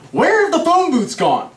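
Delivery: high, exasperated outcry; exasperated wondering. Acted. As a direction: wail the question in disbelief. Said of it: "I'm wondering".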